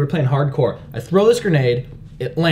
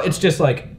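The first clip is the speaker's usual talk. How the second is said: normal talking voice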